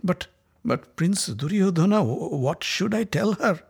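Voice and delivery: falter, scared to ask; feeble voice. Acted. Scared messenger stammering to the prince, feeble and halting. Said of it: "he said in a feeble voice".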